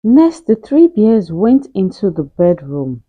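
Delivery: plain reading voice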